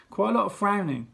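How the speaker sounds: usual speaking voice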